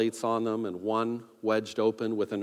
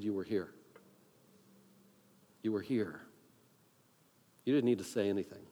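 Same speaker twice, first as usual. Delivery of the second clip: soft, lower retold comforting; gentle reassurance; 4 semitones lower, 7 dB quieter than usual. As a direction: reassure a friend quietly and low. Retold friend reassures him.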